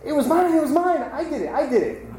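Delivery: high, excited eager student; eager claim. Acted. Head student claiming the poem before the abbot, eagerly.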